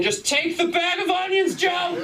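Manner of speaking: very high shouted plea; high mock scream at Joe; desperate yell. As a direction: scream it at Joe while brawling. Fighting customer yells in bit.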